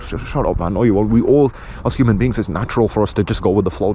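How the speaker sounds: ordinary talk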